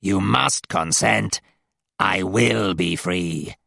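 slow, buzzing demon command. A narrator's reading. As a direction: drone it out very slowly. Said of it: "The buzzing voice".

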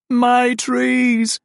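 highest, loud, drawn-out my trees; peak of the wail. Acted. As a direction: let the lament crest and hang. The farm laments its trees.

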